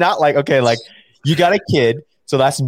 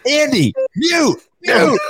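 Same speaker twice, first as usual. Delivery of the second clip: mock yell; slower than usual. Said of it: "heard Bailey be like".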